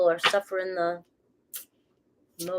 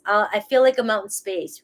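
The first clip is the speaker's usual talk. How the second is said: ordinary delivery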